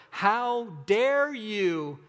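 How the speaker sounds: slow, drawn-out indignation, weight on dare; indignant how dare you. Pretend. Preacher voicing an offended person's outrage, slow and indignant.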